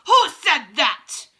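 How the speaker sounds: slow, very loud, clipped challenge; witch snapping. A narrator's reading. Witch confronting the witch who spoke, snapping. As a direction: snap it like a witch spotting a traitor. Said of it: "she snapped".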